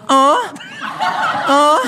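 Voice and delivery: high mock moans; two high put-on moans; imitated moaning. She keeps going with what she hears, imitated moaning.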